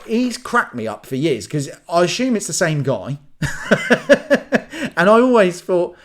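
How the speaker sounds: normal talking voice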